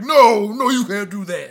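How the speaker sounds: mocking classmates. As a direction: shout him down like mean kids. Classmates mocking his dream.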